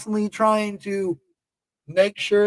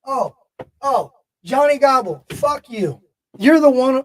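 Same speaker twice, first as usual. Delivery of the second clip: real anger building to a loud shout; angry outburst; 5 semitones higher, 7 dB louder than usual. Real, not acted. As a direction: lash out at the hater in chat, louder and louder. Ranting at a hater in chat.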